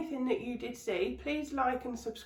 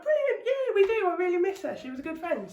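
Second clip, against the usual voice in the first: high, loud gush of delight; thrilled high reply, louder; excited; 9 semitones higher, 5 dB louder than usual. Retold or imitated. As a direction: squeal it like great news. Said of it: "I'm like".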